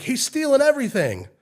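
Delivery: high, loud alarm shout; high-pitched tattling yell; kid yelling accusation. Retold or imitated. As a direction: holler it out as you run to tell Mom. Retold kid runs out yelling.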